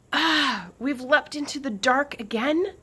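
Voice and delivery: complaining. A narrator's reading. Lucas complaining to Swift after landing. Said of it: "Lucas complained".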